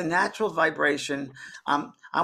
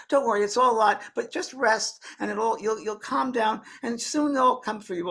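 higher put-on soothing woman; reassuring sister; 8 semitones higher than usual. Sister calming the newly passed lady, higher imitated voice. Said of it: "And her sister said".

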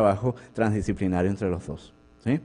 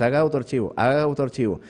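insistent, repeated scolding order; nagging like a policeman; 3 semitones higher than usual. Retold or imitated. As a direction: chase them like a cop with the demand. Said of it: "andaba como un policía atrás de ellos". (regaño insistente de policía, un poco más agudo)

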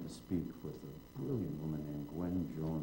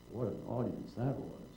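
ordinary talk, nothing marked

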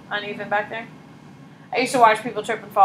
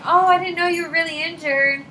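high-pitched mock realization; retold apologetic surprise; 8 semitones higher than usual. Teacher retelling laughing at a hurt girl, apologetic surprise. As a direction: act out a drawn, high "oh" of realizing.

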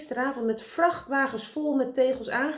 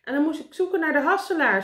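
high, loud, quick recital; shouted; 8 semitones higher, 8 dB louder, faster than usual. A narrator's reading. Karel recites his errand to meneer Pen, shouting. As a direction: rattle it back loudly from the air.

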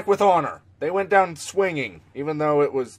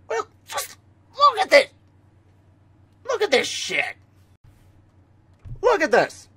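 disbelief; 12 semitones higher than usual. Real, not acted. Showing game screen in disbelief.

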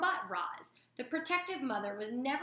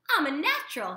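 high squeaky proud gosling; a little bird bragging; squeaky and pleased; squeaked; 4 semitones higher, 7 dB louder than usual. Acted. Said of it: "he squeaked".

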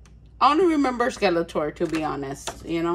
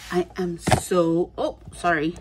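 usual speaking voice

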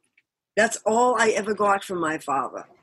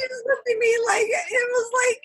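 laughing. Real, not acted. Still laughing mid-story.

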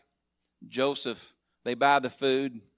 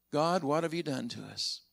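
softer, inward question to God; quiet lament; 7 dB quieter than usual. Retold or imitated. Joseph asking God in his mind, quiet lament. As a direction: murmur it as a weary private refrain. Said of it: "that refrain had been over and over".

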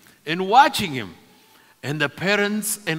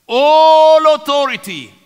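high, loud, stretched first word; thunderous proclamation; 9 semitones higher, 12 dB louder than usual. Acted. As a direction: proclaim it with divine authority. Preacher proclaims the risen Lord's words.

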